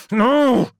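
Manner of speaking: high, loud cry of no; sudden anguished denial; cried out. Acted. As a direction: cry out in horror. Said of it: "cried Magnus".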